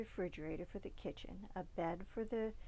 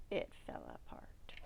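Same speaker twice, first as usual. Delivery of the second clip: hushed collapse; 6 dB quieter than usual. A narrator's reading. Floor collapsing, dramatic hush.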